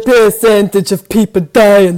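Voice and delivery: a sultry put-on Russian accent, half sung; singsong mock Russian accent; sexy Russian accent. Shown as a demonstration. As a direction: purr it like a seductive Russian. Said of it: "I like sexy Russian".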